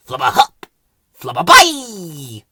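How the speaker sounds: loud, slow nonsense calls; Flowerpot gibberish. A narrator's reading. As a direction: call them out as you throw. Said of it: "went Ben".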